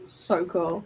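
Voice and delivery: soft, sore-throat voice; swollen tonsils voice. Real, not acted. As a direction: talk through swollen tonsils. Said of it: "I've got a bit of a sore throat".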